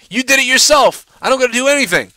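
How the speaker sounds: high, forceful accusation; indignant outburst. Real, not acted. Host tells rival he exposed himself, high indignant outburst. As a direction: throw the blame back, pitch jumping up.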